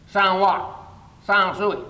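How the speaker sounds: high, loud rush of discovery; mock manic insight; manic excitement. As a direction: reel them off like a revelation. Manic revelation of code.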